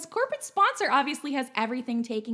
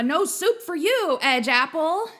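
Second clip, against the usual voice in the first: louder mock New York accent, shooing a viewer; 3 semitones higher, 5 dB louder than usual. Host greeting chat viewers, playful New York accent. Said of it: "Am I doing a good New York accent?".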